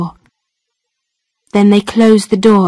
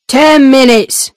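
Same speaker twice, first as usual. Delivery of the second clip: gruff guard; 3 semitones higher than usual. Acted. Guard tells the visitor his time, curt. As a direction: state the limit like a bored jailer.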